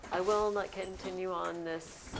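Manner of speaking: ordinary talk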